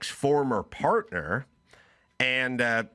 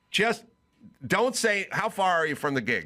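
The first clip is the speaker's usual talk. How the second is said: exasperated; 6 semitones higher than usual. Retold or imitated. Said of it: "I go".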